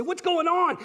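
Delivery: very high, loud urgent question; frantic excitement. Retold or imitated. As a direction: demand answers breathlessly. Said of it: "call him up and say".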